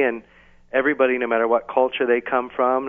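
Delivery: ordinary delivery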